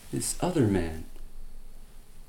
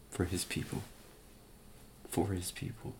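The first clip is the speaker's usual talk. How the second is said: much softer, reverent repetition; softly repeated solemn refrain; hushed; 5 semitones lower, 14 dB quieter than usual. Real, not acted. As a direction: let it fall to a near-whisper. Solemn repeated sermon climax.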